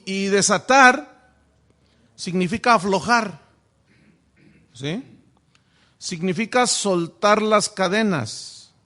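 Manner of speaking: ordinary talk, nothing marked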